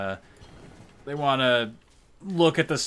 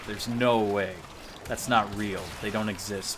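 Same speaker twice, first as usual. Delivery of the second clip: normal talking voice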